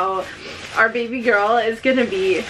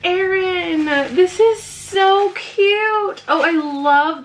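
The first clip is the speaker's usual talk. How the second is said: high, drawn-out gushing; touched delight; 7 semitones higher, slower than usual. Real, not acted. Vlogger thanking friend for baby gift, gushing delight. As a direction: gush over it, stretching the words.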